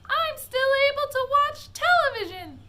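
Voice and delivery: put-on very high, loud squeaky boy's voice; tiny squeak. A narrator's reading. Shrunken boy squeaking back at his mother. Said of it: "squeaked the tiny voice of Mike T V".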